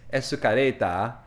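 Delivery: put-on "Escareta" in a lightly done Japanese accent; a student saying "Escareta". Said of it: "a student says".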